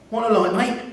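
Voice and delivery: a friendly stranger offering Alec a light, cheerily; cheery. A narrator's reading. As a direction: call it out brightly like a friendly stranger. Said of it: "said the cheery voice".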